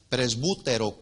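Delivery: presbútero with a U, stress on the U: pres-BU-te-ro; presbútero, acento en la U; Greek pronunciation. Shown as a demonstration. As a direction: say presbútero, B as in bueno, lean on the U. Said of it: "con B de bueno, pre-, y acento en la U".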